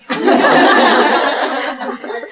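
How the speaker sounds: a high, bright burst of real laughter; big laugh. Real, not acted. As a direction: crack up at your own joke. Laughs at her own joke.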